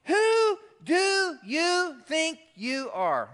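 high, loud, very slow challenge; indignant demand. Retold or imitated. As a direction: drag out the accusation word by word. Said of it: "they say the D T R question again".

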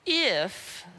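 "If" set alone, drawn out, then a pause; isolated, weighty "If"; stress on If. Shown as a demonstration. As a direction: hang on the word "If" as a big condition. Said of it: "it's a big if".

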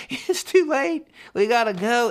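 high teasing sing-song; mock gleeful dad. Dad teasing scared daughter on coaster, high and playful. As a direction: tease her like a gleeful dad.

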